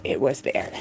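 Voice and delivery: a hushed afterthought; soft echo. Real, not acted. Quiet echo of own line.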